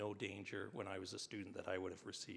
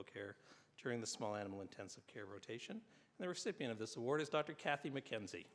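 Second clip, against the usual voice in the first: formal ceremony announcer; award announcer; 2 semitones higher than usual. Acted. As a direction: read it like an awards presenter. Reads award citations at ceremony.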